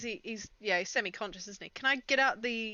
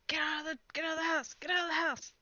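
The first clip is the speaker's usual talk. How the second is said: higher-pitched pretend shout, held back in volume; mock yell; 6 semitones higher than usual. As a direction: yell to the party with the volume reined in. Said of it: "I yell at the party".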